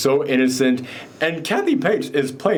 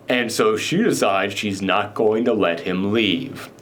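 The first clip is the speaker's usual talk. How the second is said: ordinary delivery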